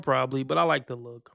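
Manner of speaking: ordinary talk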